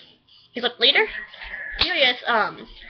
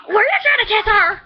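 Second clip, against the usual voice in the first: high, loud shout of "Were you trying to kiss her?"; retold shout; 9 semitones higher, 4 dB louder than usual. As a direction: yell it, jealous and shocked. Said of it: "Tamaki's like".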